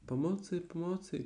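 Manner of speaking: high panicked call for help; frightened cry. Acted. Piglet sees the elephant and cries for help, high panic. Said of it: "krzyczał prosiaczek".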